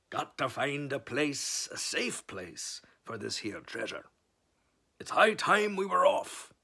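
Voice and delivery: slow, hearty pirate captain; pirate announcing. Acted. Captain announces leaving with the treasure, pirate voice. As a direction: proclaim it like a captain. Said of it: "he announced".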